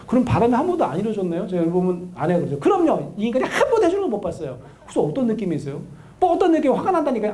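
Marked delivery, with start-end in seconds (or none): 2.6-4.6 fed-up wife. Acted. Wife complaining about husband. 6.2-7.3 irritated wife. Acted. Wife answering counselor, irritated.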